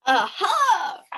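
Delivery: high, loud gotcha; triumphant aha. Acted. Officer Shrift catching out the humbug, triumphant. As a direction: pounce on it. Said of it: "interrupted Officer Shrift".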